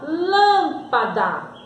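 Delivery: "lâmpada" with the first syllable LÂM drawn out; stress on LÂM. Shown as a demonstration. Teacher introducing an example word, stretching LÂM in "lâmpada". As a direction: hold the LÂM in "lâmpada", then let "pada" go. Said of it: "Olha como eu pronuncio".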